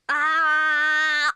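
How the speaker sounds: loud open-mouthed "Ah"; saying ah. Shown as a demonstration. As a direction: show him a big wide ah. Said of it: "Can you show George how to say, "Ah"?".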